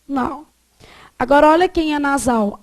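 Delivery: ordinary delivery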